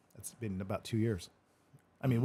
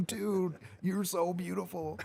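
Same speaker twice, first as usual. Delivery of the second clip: awestruck; 8 semitones higher, 4 dB quieter, slower than usual. Retold or imitated. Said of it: "And I'm like".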